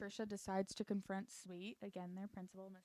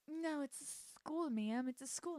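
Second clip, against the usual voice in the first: higher, slow, hesitant correction; mock gentle correction; 6 semitones higher than usual. [name] correcting the old lady, mock gently. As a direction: draw out the no, pitched up.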